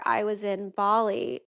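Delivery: normal talking voice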